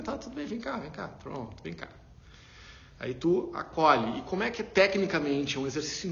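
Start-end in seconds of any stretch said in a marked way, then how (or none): 0.0-1.9 put-on soothing a child. Soothing an upset little niece.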